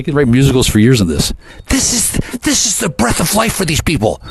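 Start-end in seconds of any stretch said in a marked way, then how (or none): 1.7-4.2 mocking voice. Mocking the people who feed on drama.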